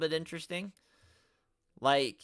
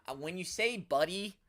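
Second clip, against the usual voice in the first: ordinary talk, nothing marked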